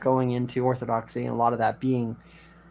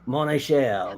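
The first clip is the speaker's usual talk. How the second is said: put-on a light Cajun accent, Gambit style; Cajun French accent. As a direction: drawl it like Gambit from X-Men. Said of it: "they speak like Gambit from X-Men".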